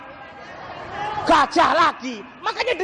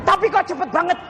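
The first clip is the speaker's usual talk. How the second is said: higher-pitched mock audience question; imitated admiring listener; 7 semitones higher, faster than usual. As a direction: lightly put on a higher voice for the amazed listener. Voices the listener's follow-up question.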